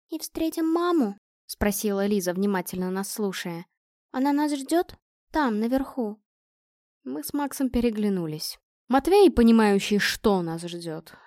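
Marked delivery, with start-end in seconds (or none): 0.1-1.2 лёгкий намёк на детский голос, выше обычного; спроси с надеждой, как маленькая девочка; детский вопрос. A narrator's reading. Ребёнок спрашивает о маме. 4.1-6.2 лёгкий намёк на голос маленькой девочки; спроси робко, как ребёнок о маме; детский вопрос. A narrator's reading. Девочка Лиза спрашивает о маме.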